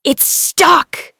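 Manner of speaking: loud strained cry; strained grunt. A narrator's reading. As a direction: grunt it while pushing. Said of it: "Singh grunted".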